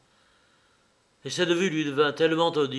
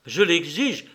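raised, louder command; imperious demand; 5 semitones higher, 5 dB louder than usual. A narrator's reading. Mother demands that her son obey, imperiously. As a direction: demand it, pitch and volume up.

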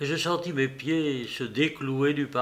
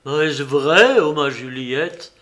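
much louder joyful cry; burst of joy; 8 dB louder than usual. Acted. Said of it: "s'écria-t-il avec un transport de joie".

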